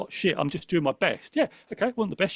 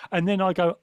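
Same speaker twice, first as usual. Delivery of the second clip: usual speaking voice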